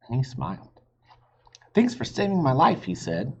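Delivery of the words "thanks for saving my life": high, quick thrilled thanks; thrilled boy. Acted. Jack thanks his sister after the ride, thrilled. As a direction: gush with relief and joy.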